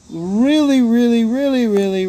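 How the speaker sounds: earnest pleading build on really; rising emphatic plea. Real, not acted. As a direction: plead harder with every really. Pleading with viewers to seek help.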